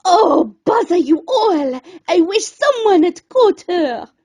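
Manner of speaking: loud, exasperated grumble; a hammed-up, fuming chef at the riverbank; dismayed chef. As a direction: vent at the whole kitchen crew. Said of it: "The chef was dismayed".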